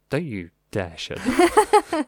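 slow, laughing protest. Real, not acted. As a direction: laugh it out slowly. Laughing reassurance.

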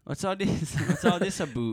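high, loud mock Canadian accent, laughing. Copying co-host's Canadian line, amused mock Canadian accent. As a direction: exaggerate "about" into "aboot", Canadian style. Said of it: "give me the Canadian accent".

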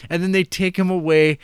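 ordinary talk, nothing marked

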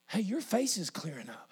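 low, soft voiced discovery; understated amazement, acted; hushed wonder. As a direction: say it quietly, as if just noticing. Leper noticing healing, voiced.

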